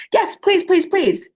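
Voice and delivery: put-on a child begging to buy the doll; eager child. Girl begs the cashier.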